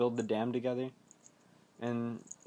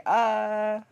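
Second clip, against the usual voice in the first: high, loud held "ah"; imitated scream of a frightened boy; mock scream; 9 semitones higher, 7 dB louder than usual. Young Ben attacked by the skeleton, high mock scream. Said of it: "Ben's all like".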